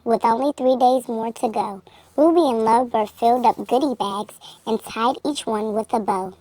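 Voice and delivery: ordinary delivery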